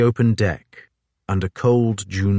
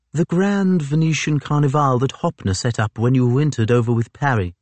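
higher officer's voice; a higher, gentlemanly officer; Fitzjames voice; 6 semitones higher than usual. A narrator's reading.

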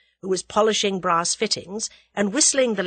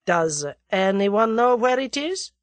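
Russian conspirator voice; 3 semitones higher than usual. A narrator's reading. Russian presses German.

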